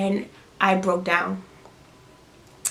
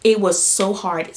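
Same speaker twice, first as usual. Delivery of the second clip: normal talking voice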